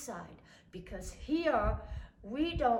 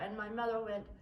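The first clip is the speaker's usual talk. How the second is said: usual speaking voice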